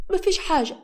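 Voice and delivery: high-pitched wounded indignation; resentful. A narrator's reading. Wronged woman answering the man who walked in, resentful. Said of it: "نوارة بصت له بغيظ".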